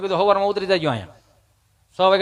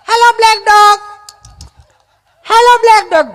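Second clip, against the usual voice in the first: mock dog calling; 8 dB louder than usual. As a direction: holler to a dog in an affected city voice. Visitor calls out to the dog.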